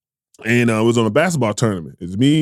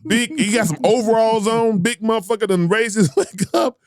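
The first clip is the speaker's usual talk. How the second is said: indignant high rant; 8 semitones higher than usual. Real, not acted. Outraged retelling.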